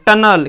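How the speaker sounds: टनल as "TUN-ul", loud and clear; teacher's loud model टनल, ending "-nul"; correct pronunciation. Shown as a demonstration. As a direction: say टनल with schwa before l. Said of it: "इसको क्या पढ़ेंगे".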